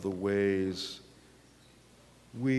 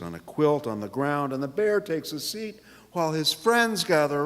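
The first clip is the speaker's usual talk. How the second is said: put-on lively read-aloud for children, pitched up; story narration; 9 semitones higher, 8 dB louder than usual. A narrator's reading. Pastor reading picture book to congregation, storytelling voice. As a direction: tell it bright and bouncy to the kids.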